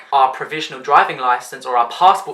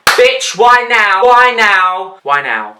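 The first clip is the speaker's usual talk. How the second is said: higher, louder why now; exasperated outburst; 5 semitones higher, 8 dB louder, much slower than usual. Retold or imitated. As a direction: yell in mock despair. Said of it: "I'm like".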